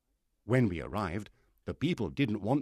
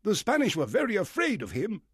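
high, loud, eager gentleman; the squire bursting to tell of Flint; excited squire; 13 semitones higher, 6 dB louder than usual. Acted. Squire voiced in story.

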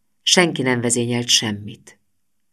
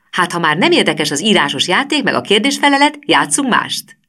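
lightly voiced teacher, higher and louder, scoffing; mocking teacher; 8 semitones higher, 4 dB louder than usual. A narrator's reading. Teacher jeering at the pupils, a hint of a shrill mock. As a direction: tease the gloomy class, a touch raised. Hormici goading the class.

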